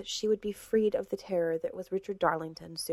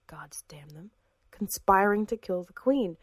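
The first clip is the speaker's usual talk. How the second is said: usual speaking voice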